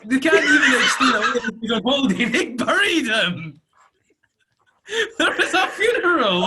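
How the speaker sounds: high, loud, laughing through the words; real hysterical laughing disbelief; helpless laughter. Real, not acted. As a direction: squeal it out while cracking up. Cracking up over the plot hole.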